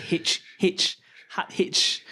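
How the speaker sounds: H said as a breathed "haitch"; hard H. Shown as a demonstration. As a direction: puff out a hard H at the start of "haitch". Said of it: "pronounced it with the hard H".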